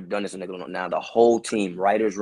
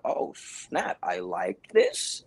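awed delight; 9 semitones higher, 4 dB quieter, much slower than usual. Retold or imitated. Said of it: "I'm like".

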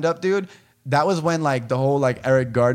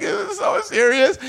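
much higher, wailing imitation of the crying girl; mock hysterical crying. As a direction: whine it like a sobbing girl, hammed-up. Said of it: "like, crying hysterically".

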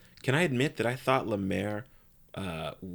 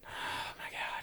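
whispered, much softer; hushed whisper; 11 dB quieter, much slower than usual. Real, not acted. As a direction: gasp it out under your breath. Scared by ghost talk.

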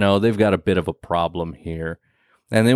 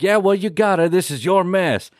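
stern boss; 8 semitones higher than usual. Retold or imitated. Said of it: "And he's like".

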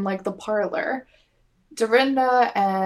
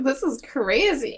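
"crazy" stretched slowly, pitched up; drawn-out exclamation; 4 semitones higher, slower than usual. Retold or imitated. Co-host quoting a cast member, "crazy" stretched out in mock amazement. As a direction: imitate amazement, dragging out "crazy".

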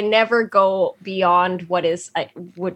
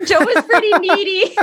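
laughing; 13 semitones higher, 5 dB louder than usual. Real, not acted. Laughing teasing about Joe.

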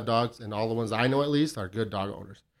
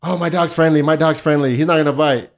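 mock breezy assurance; 3 semitones higher, 8 dB louder than usual. Dog owner reassuring a nervous passer-by about a loose dog, mock-breezy assurance. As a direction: imitate dog owners insisting, loud and raised.

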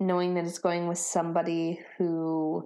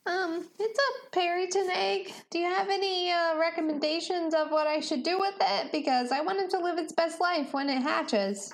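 put-on lightly raised, earnest young Benny; a gentle, hopeful character voice, a bit higher; Benny's voice; 10 semitones higher than usual.

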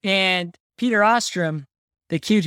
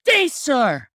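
play-acted shout; mock yell; 7 semitones higher than usual. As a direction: yell it like an indignant movie quote. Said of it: "I yell, "Good day, sir".

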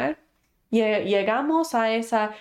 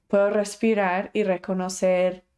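normal talking voice

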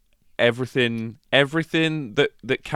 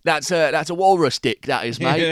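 higher, louder Cockney gangster voice; Cockney Bricktop impression; 6 semitones higher, 4 dB louder than usual. Podcaster keeps up the Bricktop bit in a Cockney voice. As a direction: play a menacing Cockney host. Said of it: "terrible, impressions of Bricktop from Snatch".

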